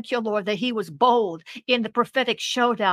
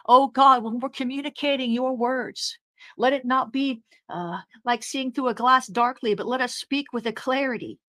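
normal talking voice

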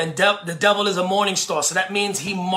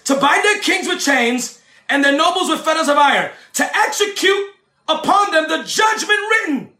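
much higher, shouted fiery preaching with laughs; preaching at full force; 9 semitones higher than usual. Acted. Preacher shouting to the congregation that heaven is a courtroom, fiery. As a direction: preach the kingdom at the top of your voice.

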